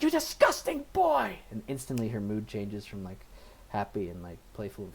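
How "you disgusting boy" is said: much higher, slow, loud cry of disgust; disgusted mother. Retold or imitated. Mother scolding her son in bed, disgusted shout. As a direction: spit it at him like a repulsed mother. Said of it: "And she's like".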